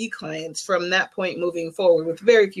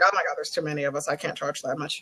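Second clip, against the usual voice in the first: fast anxious mutter; 3 semitones lower, 7 dB quieter, faster than usual. Retold or imitated. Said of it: "So, like".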